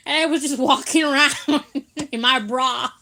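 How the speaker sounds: amused, laugh-broken storytelling; real laughter. Real, not acted. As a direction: tell it while cracking up. Cracks up telling own story.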